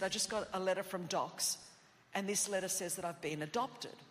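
normal talking voice

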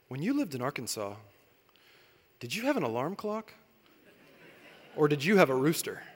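put-on hushed curious child; daughter's voice. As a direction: ask earnestly like a small child. Daughter asks about Arkansas.

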